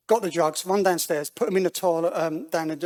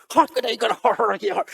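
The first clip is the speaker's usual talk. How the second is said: high, loud mock Irish accent; bad Irish accent; 6 semitones higher, 7 dB louder than usual. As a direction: put on a hammed-up Irish brogue. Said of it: "top of the morning Irish person".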